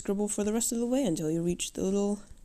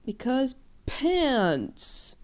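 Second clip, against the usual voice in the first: silly shout; 6 semitones higher, 5 dB louder, much slower than usual. Real, not acted. Sudden silly exclamation.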